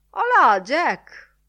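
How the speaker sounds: loud, high, drawn-out greeting call; hailing. A narrator's reading. Editor greeting Jack, calling out. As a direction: hail him heartily across the room.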